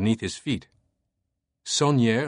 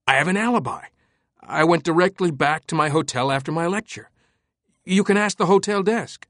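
higher, louder panicked protest; desperate protest; 7 semitones higher, 3 dB louder than usual. Acted. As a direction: plead your alibi in alarm. Langdon protesting alibi.

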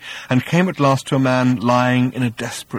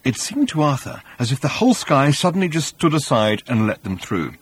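plain reading voice

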